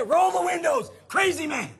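loud, high urgent shout; mock alarm. Somebody in the car warning of a crazy man, mock-alarmed shout. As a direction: call it out like an acted panic. Imagined person urging windows up.

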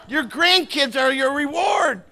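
loud, high, drawn-out punchline; emphatic exclamation. Real, not acted. Preacher telling parents about grandkids, loud emphatic punchline. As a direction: proclaim it slowly and loudly.

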